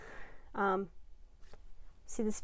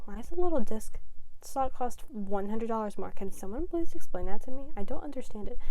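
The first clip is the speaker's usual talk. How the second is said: exasperated rant; 8 dB louder than usual. Real, not acted. Ranting about PS5 price.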